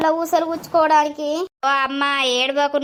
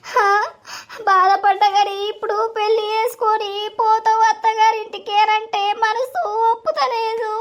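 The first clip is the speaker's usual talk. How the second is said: high, wailing sob-song that slows at the end; crying; 8 semitones higher than usual. Acted. Grandmother wailing as her granddaughter leaves after the wedding. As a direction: lament with a broken sing-song cry, drawing it out. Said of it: "ఏడవకమ్మా".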